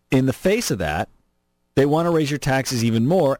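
normal talking voice

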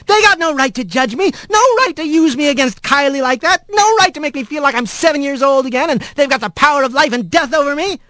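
high-pitched angry rant, gathering speed; Rat, indignant. Acted. Rat protests to the voice in the dark, high and indignant. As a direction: rail high and fast at the injustice.

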